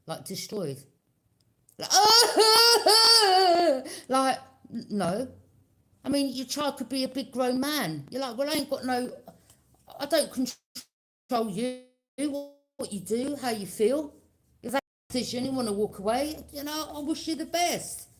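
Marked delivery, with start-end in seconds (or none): 2.0-4.0 loud, high laugh breaking out; real burst of laughter. Real, not acted. Bursts out laughing mid-reading. 8.4-18.1 higher and louder, brushing them off; firm dismissal. Retold or imitated. You're like, dismissing family.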